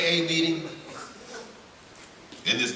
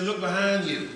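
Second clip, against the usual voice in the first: higher, lightly voiced old lady; a hint of a kindly old woman directing; mild old lady voice; 6 semitones higher than usual.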